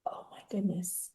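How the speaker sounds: hushed, whispered amazement; breathy whispered awe, barely voiced; awed whisper. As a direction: whisper it in grateful disbelief. Acts awed reaction to God's timing.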